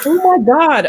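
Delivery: exasperated. Real, not acted. Host exasperated that cohost is working on son's birthday. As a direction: throw up your hands at a friend.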